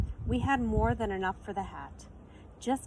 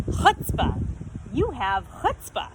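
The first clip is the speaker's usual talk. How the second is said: admiring; 3 semitones higher, 7 dB louder than usual. A narrator's reading. Shopkeeper praising the children's nerve, loud emphatic admiration. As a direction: punch the word Chutzpah.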